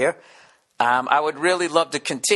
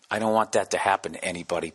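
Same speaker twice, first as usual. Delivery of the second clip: usual speaking voice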